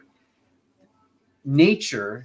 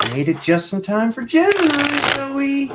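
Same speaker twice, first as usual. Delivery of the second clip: pet-talk voice; 7 dB louder than usual. Real, not acted. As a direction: squeal a greeting to the pet. Greets cat in pet voice.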